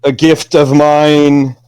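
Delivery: bestow the gift like a solemn lord; grandly granting a wish. Acted. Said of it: "a hand reaches out ... towards you".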